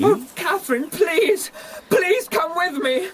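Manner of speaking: cling to her with your voice; desperate pleading. Acted.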